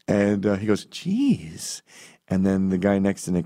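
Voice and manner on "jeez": mock hurt. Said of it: "pretends to be really hurt".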